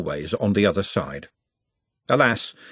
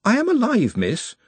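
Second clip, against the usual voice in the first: high-pitched, helpless protest; 12 semitones higher than usual. A narrator's reading. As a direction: plead meekly with the cook, dropping on "miss". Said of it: "said Nut helplessly".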